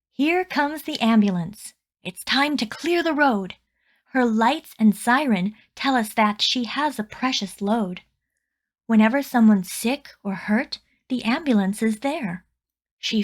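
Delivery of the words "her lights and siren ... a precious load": usual speaking voice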